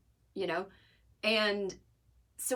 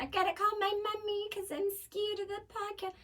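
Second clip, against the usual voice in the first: put-on high, childish whine of a frightened kid; mock scared little kid. Comic mocking himself as a kid scared of the podcast, high childish whine. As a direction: play a scared little boy begging for mommy. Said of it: "I'm not that kind of person".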